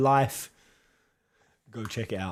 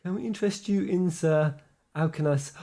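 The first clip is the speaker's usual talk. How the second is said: ordinary talk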